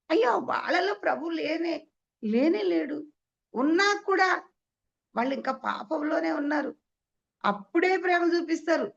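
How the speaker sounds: high-pitched exclaiming sermon voice; impassioned preaching. Real, not acted. Preacher lamenting those still in sin, impassioned preaching. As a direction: cry it out to the congregation, pitched high.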